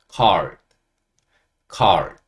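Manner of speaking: called as English card, loud, low and falling; English pronunciation. Shown as a demonstration. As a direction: model called as "card" with a curled r. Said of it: "이렇게 읽으시면 됩니다".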